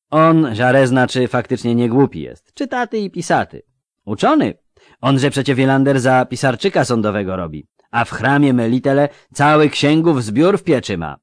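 eager country lad voice; rustic young recruit. Acted. Melfi boasts of Jare.

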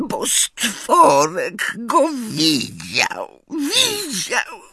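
very high, slow, sobbing house-elf. A narrator's reading. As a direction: blubber it out through tears. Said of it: "wychlipiał skrzat".

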